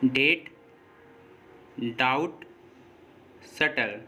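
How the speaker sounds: डेट, डाउट, सटल with no B before the T; silent B. Shown as a demonstration. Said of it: "silent रहता है". (डेट, डाउट, सटल में T से पहले बी silent)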